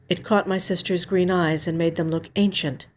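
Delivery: ordinary delivery, nothing marked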